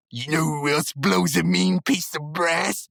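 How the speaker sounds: slow, teasing mock voice; a light Muscle Man setting up his joke. Reviewer voicing Muscle Man's joke setup, slow and teasing. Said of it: "the Muscle Man goes".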